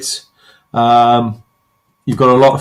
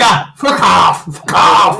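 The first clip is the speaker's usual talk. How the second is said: slow, hammed-up shouted brush-off; slow mock yell of fuck off; 3 semitones higher, slower than usual. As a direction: bark it out like an annoyed pop star, half joking. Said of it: "She'd be like".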